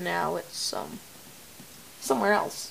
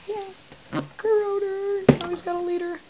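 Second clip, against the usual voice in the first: high goofy voice; 10 semitones higher than usual. Pretend. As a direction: squeak the name playfully.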